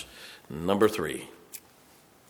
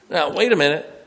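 normal talking voice

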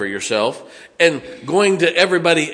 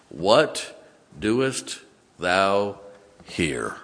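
very slow, drawn out; 5 semitones lower, 4 dB quieter, much slower than usual. Acted. God asks Elijah a searching question, very slowly. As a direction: stretch each word of God's question.

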